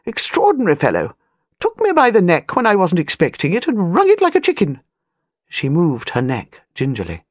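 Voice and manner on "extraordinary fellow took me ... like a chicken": a hint of a bossy elderly aristocrat, higher; an old lady of rank, talking down a little; airy grande dame, lightly done; lightly voiced imperious old lady.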